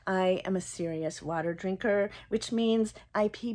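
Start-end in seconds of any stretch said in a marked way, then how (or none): none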